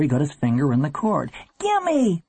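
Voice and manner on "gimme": very high, insistent cry; high-pitched grabby yelp; desperate demand. A narrator's reading. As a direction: demand it like a frantic child. Boy grabs for the phone.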